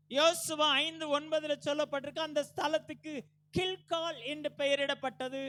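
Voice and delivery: loud, high pulpit cry; full-force preaching. Real, not acted. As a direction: preach it out with each phrase driven hard.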